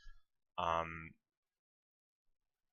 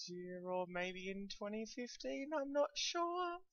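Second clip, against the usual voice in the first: rising at the end of phrases; uptalk; 10 semitones higher, 8 dB quieter than usual. Real, not acted. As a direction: lift the ends up like questions. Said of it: "you can tell I'm Australian because my voice goes up at the end of questions".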